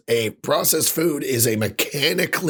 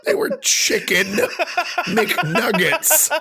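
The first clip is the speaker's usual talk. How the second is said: laughing disbelief; slower than usual. Real, not acted. Mocking McDonald's ad, laughing hard. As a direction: crack up at the ad.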